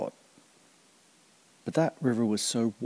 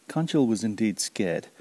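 plain reading voice

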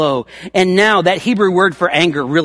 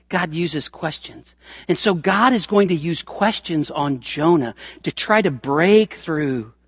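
ordinary delivery

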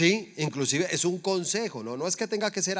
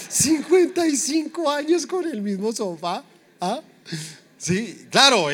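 high, drawn-out incredulous exclamation; comically scandalized, pitched way up; mock outrage; 9 semitones higher, 5 dB louder, slower than usual. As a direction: squeal it slowly in disbelief. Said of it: "Pero yo decía, "Mucho descarado".

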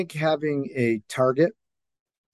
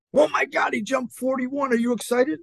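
higher, breathless imitation of a gushing parent; mock excited gush; 9 semitones higher than usual. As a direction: play up someone thrilled by the jump. Retold fan gushing at tryout.

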